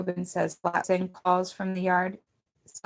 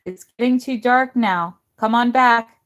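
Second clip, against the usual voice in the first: loud high call from the back door; 5 semitones higher, 11 dB louder than usual. A narrator's reading. Missus Dickerson calls the kids in from her back door, loud high call. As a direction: call the kids in across the yard. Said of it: "calling us from her back door".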